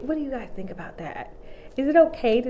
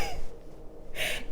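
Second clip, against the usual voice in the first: squealing giggle; 10 dB quieter than usual. Real, not acted. Giggling at sassy old people.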